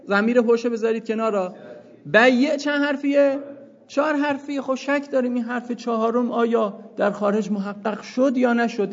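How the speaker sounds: usual speaking voice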